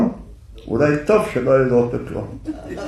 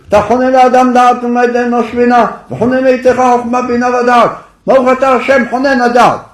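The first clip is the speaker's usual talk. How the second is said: prays much higher and loud, demanding; angry shouted prayer; 10 semitones higher, 7 dB louder, faster than usual. Retold or imitated. Angry man demands from heaven in shouting prayer. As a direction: hurl the blessing at heaven in anger. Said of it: "הוא צועק".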